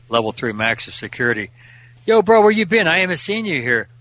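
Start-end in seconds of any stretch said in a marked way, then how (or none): 2.1-3.9 loud, high greeting across the yard; calling out. Retold or imitated. Chaplain calls out to an inmate across the prison yard, loud and high. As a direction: hail him from a distance, higher and louder.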